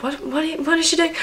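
lightly voiced bewildered classmates; a hint of confused staring class; baffled classmates. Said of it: "looked at me like".

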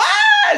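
very high squealed scream; imitated panicked shriek; mock scream. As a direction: squeal like a fleeing crowd. Mimics people fleeing in story.

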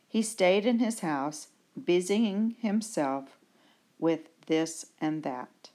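ordinary delivery

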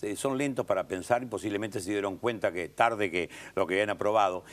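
ordinary delivery

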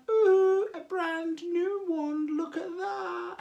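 high, slow, drawn-out cooing; awed reveal. Acted. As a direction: unveil it in slow, high-pitched wonder. Reveals a new magic wand.

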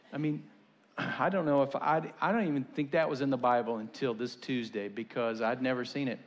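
ordinary talk

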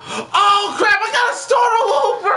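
high, fast exasperated yell; real outburst at losing progress; loud frustrated gamer yell; frustrated shout. Real, not acted. Game progress lost, shouting.

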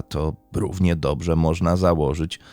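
ordinary delivery

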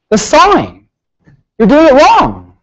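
high, firm retold telling-off; scolding correction. Shop tech scolding the student's sawing, high and firm. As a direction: call it out as the know-all tech.

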